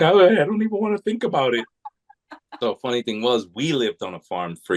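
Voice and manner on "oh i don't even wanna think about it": high, fast, rushed mock fear; imitating the wife, shaken, rattling it off; wife shivering at the Bigfoot question, high and fast; scared wife. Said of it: "She's like".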